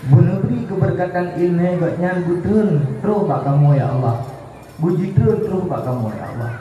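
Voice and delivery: leading a prayer. Real, not acted. Leads the closing prayer.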